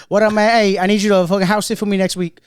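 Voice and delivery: lightly voiced Memphis accent, greeting loud and high. Regular calling the bartender in a Memphis accent. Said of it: "He sound like [name]".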